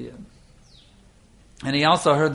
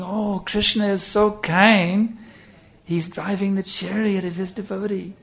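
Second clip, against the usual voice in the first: tearful devotion; 7 semitones higher, slower than usual. Retold or imitated. Brahmin describes weeping over Krishna.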